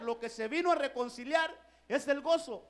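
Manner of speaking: ordinary delivery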